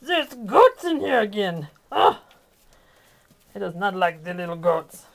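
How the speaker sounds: cranky rooster complaining about the goats; voicing the rooster. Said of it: "He's like".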